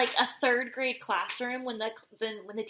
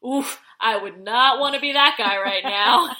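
slow, laughing sympathetic wince; 8 dB louder than usual. Retold or imitated.

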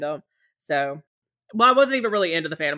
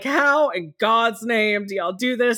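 mock-despairing, higher and louder, slowing at the start; hammed-up misery, pitch climbing higher; exasperated groan; 7 semitones higher, 4 dB louder than usual. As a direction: act out being fed up at three A M. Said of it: "And I was like".

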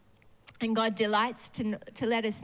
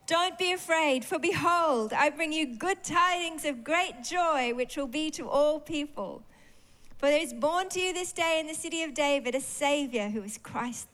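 angel's proclamation; 5 semitones higher than usual. Acted. Angel voiced in scripture reading.

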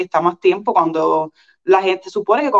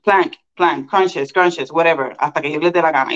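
ordinary talk

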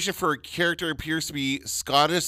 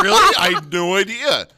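much higher and louder sarcastic surprise; mock amazement; 8 semitones higher, 8 dB louder than usual. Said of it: "I'm like".

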